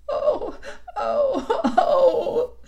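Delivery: high wailing sobs; sobbing. A narrator's reading. Mister Tumnus answers Lucy's scolding, sobbing. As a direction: wail each cry through tears. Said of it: "sobbed Mister Tumnus".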